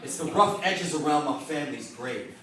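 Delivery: rhythmic slam poetry delivery, building in pitch; spoken word poem. Acted. Poet honoring his immigrant father's accent, rhythmic spoken word. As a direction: recite it as impassioned spoken word.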